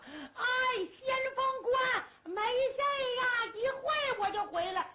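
high, drawn-out carefree calling; calling from afar. Acted. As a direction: shout back over the wall. 在寨内远远喊话. (隔着寨门高声喊话)

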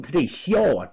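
usual speaking voice

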